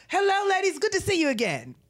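hello swooped very high, darling dropped; a grand sing-song hello, darling; Andre Leon Talley impression. Storyteller voices Andre waving hello around town, swooping high.

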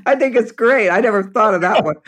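high-pitched, talking through real laughter; amused laughing. Real, not acted. Reacting to friend's horny cheat code, laughing. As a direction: squeeze the words out between laughs. Laughing hard at the other's joke.

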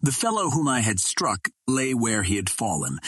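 ordinary delivery

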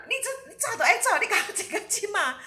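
high, loud, laughing through it; can't stop laughing while quoting; laughing retelling. Real, not acted. [name] laughing as she repeats the rude line, high and loud.

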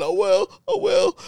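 very high, near-crying wail of confusion; panicked Mike. Retold or imitated. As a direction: yelp it out high and scared. Mike agreeing, scared.

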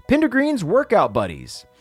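ordinary delivery

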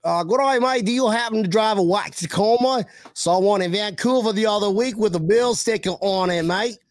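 mock Aussie bloke voice; hammed-up Australian twang; Australian accent.

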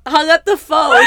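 high, loud laughing words; laughing while telling. Real, not acted. Recalling husband hanging up, laughing.

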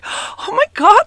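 high, slow, gasping oh my God; a panicked squeak of alarm; drawn-out high-pitched oh my God; shocked gasp. Retold or imitated. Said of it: "I'm like".

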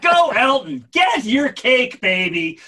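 mock cheering. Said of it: "I'm like".

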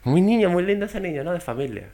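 high, lightly mocking mother; mock mom voice. Friend imitates his mom's remark, lightly high and mocking. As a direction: sweetly imitate a prim mother. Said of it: "O sea".

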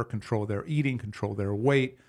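usual speaking voice

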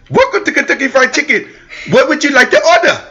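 high put-on cashier voice; mock cashier voice. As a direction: play the drive-thru worker. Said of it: "a Caucasian voice".

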